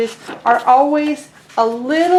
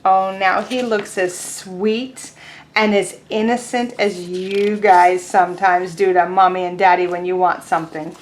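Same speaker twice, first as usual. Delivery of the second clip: ordinary talk, nothing marked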